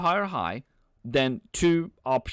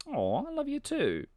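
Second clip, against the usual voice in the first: much higher, soft and sweet; cutesy reply; 13 semitones higher, 3 dB quieter than usual. Pretend. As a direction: coo it back playfully. Answering a viewer's "I love you.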